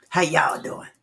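ordinary delivery

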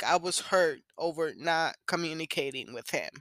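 ordinary talk

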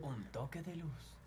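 very slow, hushed station tagline; whispery, lingering radio announcer; radio programme voice. Acted. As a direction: imitate a soft, drawn-out radio ident. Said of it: "el de la radio".

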